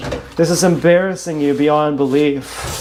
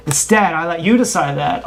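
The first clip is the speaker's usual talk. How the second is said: ordinary delivery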